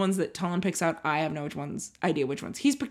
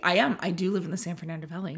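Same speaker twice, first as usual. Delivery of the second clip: ordinary talk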